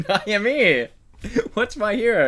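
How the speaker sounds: high, loud, hammed-up hero cry; playful over-the-top heroic announcement; mock heroic catchphrase. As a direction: proclaim it like a cartoon hero.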